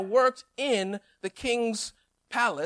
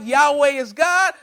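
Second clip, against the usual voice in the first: slow, louder triumphant shout; cheering crowd; 3 semitones higher, 7 dB louder than usual. Retold or imitated. Elijah imagines Jezreel cheering him, a slow shout. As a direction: hail it like a welcoming crowd. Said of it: "Everybody's like".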